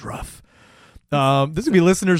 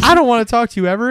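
mock yell; 10 semitones higher, 5 dB louder, faster than usual. Acting the hurt woman.

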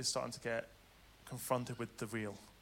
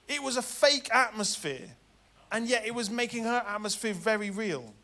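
preach; 9 semitones higher, 7 dB louder than usual. Acted.

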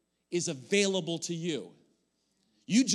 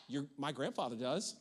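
usual speaking voice

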